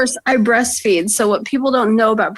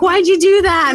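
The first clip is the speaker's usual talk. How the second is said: high, louder panicked question; panicked boyfriend; 8 semitones higher, 4 dB louder than usual. Retold or imitated. Imitating boyfriend freaked out at the doctor's, panicky. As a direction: demand it in mock panic. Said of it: "He's like".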